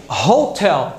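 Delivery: "hotel" with a breathed H at the start; sounded H. Shown as a demonstration. Teacher gives a consonant-sound example, audible H. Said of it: "O H tem som de R normal".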